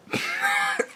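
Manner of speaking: high laugh. Real, not acted. Reacting to the show's twist.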